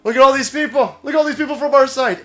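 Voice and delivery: high, loud pointing out; high astonished look-at-this; mock amazement. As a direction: show off the healthy people in disbelief. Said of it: "we sit there and we go".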